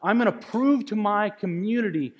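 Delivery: preaching at full force. Acted. Preaching climax.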